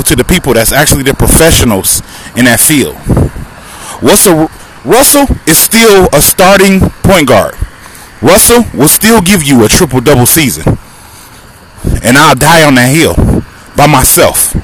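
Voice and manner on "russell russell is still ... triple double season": fired-up insistence. Real, not acted. Podcaster defending Russell to the listeners, fired up. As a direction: lean into every word, defending him.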